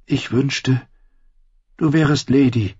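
a hint of a young girl; lightly voiced Sansa. Sansa speaks wistfully to the old blind dog.

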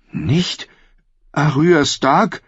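high, slow, stunned question; shocked disbelief. Acted. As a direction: she can't believe it.

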